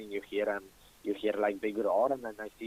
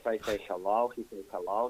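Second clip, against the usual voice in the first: ordinary talk, nothing marked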